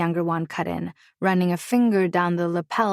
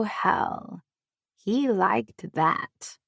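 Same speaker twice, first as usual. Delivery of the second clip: ordinary delivery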